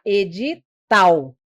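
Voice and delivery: edital slowly split, with tal set apart; edi, then tal; last syllable singled out. Shown as a demonstration. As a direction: pause before a strong TAL in edital. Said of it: "Vou destacar só a última".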